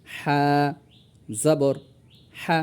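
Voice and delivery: "হা, জবর হা" in three separate pieces; drawn-out letter name, then each part on its own; separated. Shown as a demonstration. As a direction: pause clearly after "হা" and after "জবর". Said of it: "এজন্য ছাড়া ছাড়াভাবে আমি".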